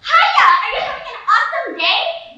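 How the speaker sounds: high, loud, over-bright greeting; chirpy counter girl. Retold or imitated. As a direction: greet like an overeager fast-food cashier. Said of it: "the girl behind the counter said".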